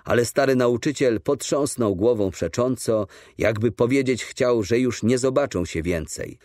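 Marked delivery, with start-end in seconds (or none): none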